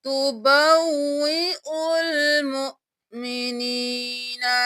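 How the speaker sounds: slow recitation with a doubled waw; Quranic recitation. Shown as a demonstration. Teacher reciting the verse for students. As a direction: chant तुबव्विउल मुमिनीना, stressing the double waw. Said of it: "या को नहीं पढ़ेंगे, वाव को दो बार".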